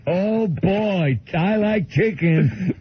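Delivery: gleeful, stretched-out caricature; Bill. Retold or imitated. Bill delighting in a platter of chicken, slow high caricature. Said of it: "starts mauling the entire platter of chicken".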